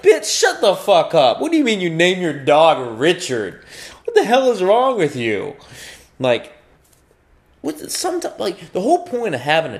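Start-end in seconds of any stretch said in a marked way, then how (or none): 0.0-5.5 loud, high-pitched indignant rant; outraged yelling. Acted. Passerby berates owner for naming dog Richard, outraged yelling. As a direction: tear into them in disbelief.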